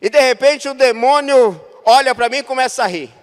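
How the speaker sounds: loud mocking demon cackle; demonic laugh. (risada demoníaca alta)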